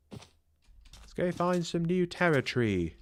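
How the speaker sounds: slow mock British accent. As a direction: try an English accent again, slowly. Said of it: "Rate my British accent".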